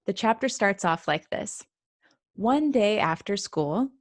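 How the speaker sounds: ordinary delivery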